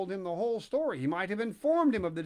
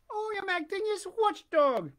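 put-on high, squeaky wooden-boy voice; high puppet voice; 13 semitones higher, 3 dB louder than usual. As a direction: pipe it up like a puppet.

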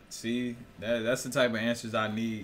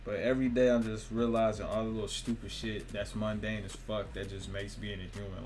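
normal talking voice

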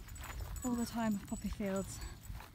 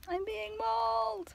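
high, drawn-out playful wail; mock distress; 9 semitones higher, slower than usual. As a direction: pretend you're under attack, long and high. Playful protest as dog climbs on her.

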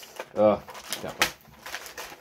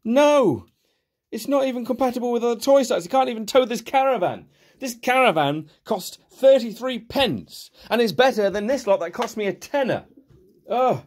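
exasperated rant; 11 semitones higher than usual. Real, not acted. Reviewer scoffing at a toy that can't tow, indignant. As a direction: pitch up and scoff at it.

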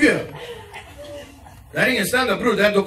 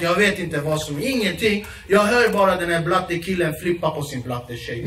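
ordinary talk, nothing marked

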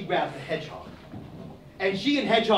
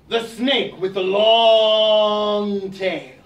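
drawn-out snake; 3 dB louder, much slower than usual. Acted. Nyame lists the snake.

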